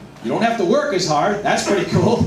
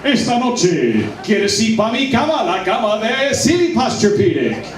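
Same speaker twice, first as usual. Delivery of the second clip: mock seductive pickup line. Comic trying to seduce a woman in his goofy voice. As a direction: ham up a seductive invitation in the announcer voice. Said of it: "ask a nice-looking woman to go to bed in that voice".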